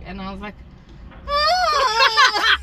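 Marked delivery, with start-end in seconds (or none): 1.3-2.6 loud, high drawn-out "ah"; caught-out wail. Retold or imitated. Retelling being asked about leaving after the kiss, mock-panicked wail.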